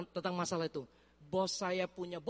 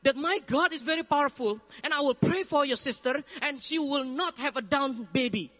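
loud, higher, confident declaration; raised, certain voice promising a healing; bold promise; 6 semitones higher, 4 dB louder than usual. Retold or imitated. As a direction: proclaim it boldly to the boss. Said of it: "langsung saya bilang".

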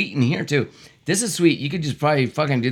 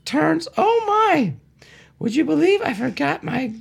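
high nervous driver voice; 13 semitones higher than usual. Acted. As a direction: squeak it out like a flustered driver. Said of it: "Do different voices".

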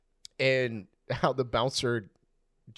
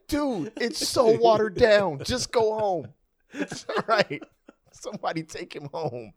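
exasperated friend; 10 semitones higher, 4 dB louder than usual. Retold or imitated. Imitating reply to the drunk.

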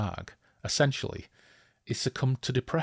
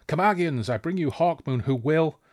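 high-pitched proclamation cut short; hailing call; 6 semitones higher than usual. Acted. Rider hailing the defenders before fire hits him, calling out. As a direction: announce it to the defenders.

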